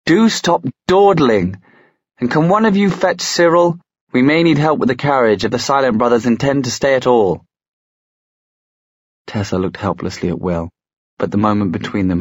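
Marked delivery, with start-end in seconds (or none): 0.0-7.4 high-pitched brisk summons from downstairs; calling up. A narrator's reading. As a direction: call out high, chiding them along. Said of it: "calling up to them from the entryway".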